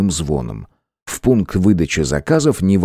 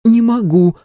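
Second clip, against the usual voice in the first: high, loud stubborn refusal; 4 dB louder than usual. A narrator's reading. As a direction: dig in and refuse. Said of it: "напала вдруг на неё странная настырность".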